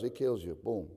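ordinary delivery

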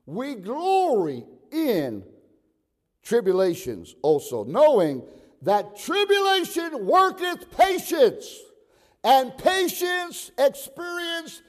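loud, high, drawn-out preaching; fervent pulpit proclamation; declaimed scripture. Real, not acted. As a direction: stretch each key word and ring it out. Scripture declaimed at full force.

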